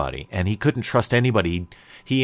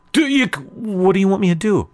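exasperated protest; 8 semitones higher, 4 dB louder than usual. Acted. Brother protests when scolded.

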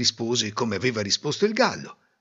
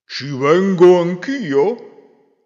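wolf voice; 4 semitones higher, 8 dB louder, much slower than usual. Acted. Wolf asks to come.

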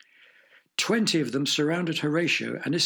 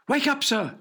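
urgent servant; 11 semitones higher than usual. Acted. Servant wakes sleeping master.

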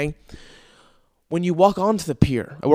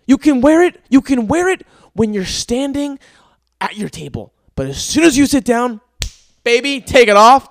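higher, louder sing-song mockery of the rules; mock the rule like it's absurd; 8 semitones higher, 7 dB louder than usual. Mimicking management's mask rules, loud sarcastic mockery.

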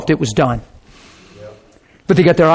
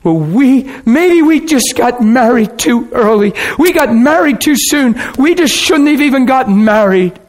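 cried out; 6 semitones higher than usual. Retold or imitated. The wife crying out to her husband, a high hurt outburst. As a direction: blurt it out in hurt frustration. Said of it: "she cried out".

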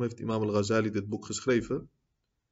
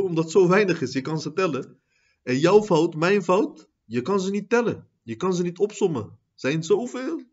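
preach; 7 semitones higher, 6 dB louder than usual. Acted.

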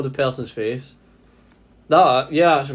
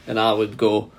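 normal talking voice

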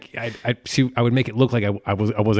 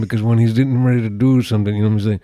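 put-on loud, garbled Irish Traveller accent; Mickey from Snatch; 5 dB louder than usual. Podcaster riffing as a film character, loud Irish accent. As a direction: ramble loudly in a thick Irish accent.

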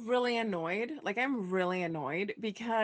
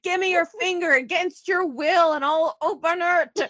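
mock loud demand; 6 semitones higher, 7 dB louder than usual. Acted demand to Sonny.

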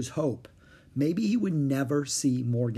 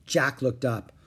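usual speaking voice

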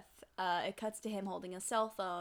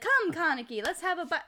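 high, loud mock invitation; hammed-up villain calling his prey over; theatrical, raised-voice taunt; lightly voiced villain; 8 semitones higher, 9 dB louder than usual. Acted villain invites his victim.

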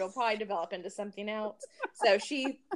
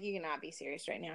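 retold disbelief; 4 semitones lower, 10 dB quieter, faster than usual. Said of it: "I was like".

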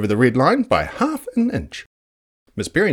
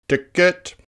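gruff ticket; 9 semitones higher than usual. Acted. Woebegone slaps a ticket.

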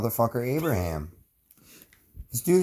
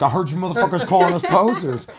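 louder, higher redcoat challenge; skit redcoat; 5 semitones higher, 5 dB louder than usual. Pretend. Redcoat confronts the colonists in the skit, loud higher challenge. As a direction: call them out like an angry redcoat. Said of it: "Let's do a skit".

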